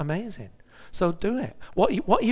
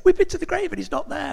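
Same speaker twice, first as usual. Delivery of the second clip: high, fast, flustered women's voice; breathless women; 13 semitones higher, 7 dB louder, faster than usual. Retold or imitated. Women back from the grave, high flustered report. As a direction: rush it out high like startled women.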